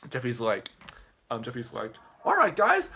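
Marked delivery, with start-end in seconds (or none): 2.2-2.9 high, loud mock YouTuber greeting; hyped video intro. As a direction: open the video with put-on pep. Acted video host's opening.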